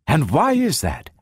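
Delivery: high, eager questioning; mounting excitement. A narrator's reading. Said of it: "Doctor Calvin was speaking in mounting excitement".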